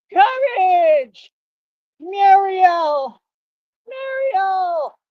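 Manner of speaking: high, long-held name calls; cartoon calling out. Imitating the dog show, calling Courage and Muriel, high cartoon cry. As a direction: call the names out high and let them fall away.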